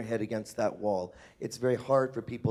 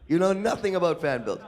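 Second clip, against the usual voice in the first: laughing tease; 6 semitones higher, 6 dB louder than usual. Acted. Teasing conclusion, laughing.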